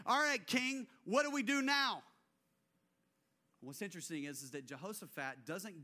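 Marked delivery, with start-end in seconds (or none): 0.1-2.0 louder, higher, pleading panic; frightened plea. Retold or imitated. Frightened people begging their king, loud and panicked. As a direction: beg the king in alarm.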